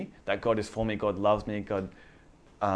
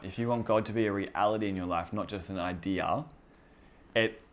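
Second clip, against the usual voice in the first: ordinary delivery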